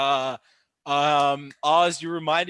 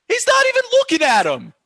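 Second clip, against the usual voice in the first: very high, loud indignant yell; outraged rant; 10 dB louder, faster than usual. Real, not acted. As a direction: shout your disbelief at the referee. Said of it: "Sorry, my little rant".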